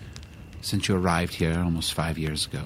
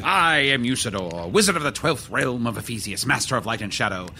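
grand wizard proclaiming his titles; pompous wizard; 4 semitones higher than usual. Acted. Wizard introducing himself by his many names, pompous and grand. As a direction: roll out each title with ceremony.

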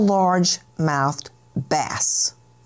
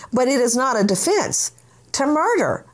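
ordinary talk

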